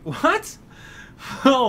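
high burst of laughing surprise; laughing disbelief. Real, not acted. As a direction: crack up mid-question. Laughing at the chauvinist pig line.

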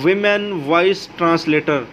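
very slow, each word set apart for typing; spelled out slowly. Shown as a demonstration. Tutorial host dictating a search term to viewers, very slowly. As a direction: say the app name slowly so viewers can type it. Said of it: "वहाँ पे लिखना ये है".

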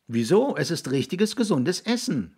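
put-on high, puzzled boyish objection; contradicting. A narrator's reading. Jiggs defending the food to William, contradicting. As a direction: object brightly, pitched well up. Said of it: "widersprach Jiggs".

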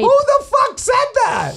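high, giddy catchphrase yell; hammed-up cartoonish yell; excited shout. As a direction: shout the show title with glee. Silly contestant shouts catchphrase.

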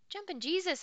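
high, loud startled exclamation; exclaimed. Acted. Boy hearing she will stay all winter, exclaiming. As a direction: blurt it out in shock. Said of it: "I exclaimed".

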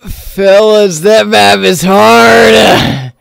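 low, very slow, stretched words; drawn-out exhausted verdict. Real, not acted. Gamer telling fellas about the map, slowly drawn out. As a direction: drag each word out, stretching the last.

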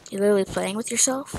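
normal talking voice

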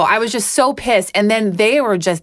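ordinary talk, nothing marked

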